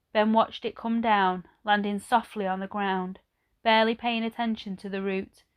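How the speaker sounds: usual speaking voice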